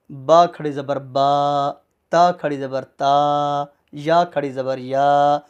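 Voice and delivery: slow, level chant of the letters; long aa on each final syllable; stretched vowel drill. Shown as a demonstration. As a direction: spell it out, holding "با", "تا", "یا" long. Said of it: "ان سب کو کھینچ کر پڑھتے ہیں".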